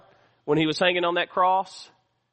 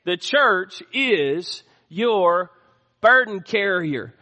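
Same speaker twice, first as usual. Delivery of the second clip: slow emphatic repeat; slower than usual. Real, not acted. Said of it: "listen to me, church".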